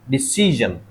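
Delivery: Decision with a long stressed middle "see", then falling. Shown as a demonstration. Teacher answers where stress goes, decision with stressed "SEE". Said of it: "तो कहाँ पे लगेगा?".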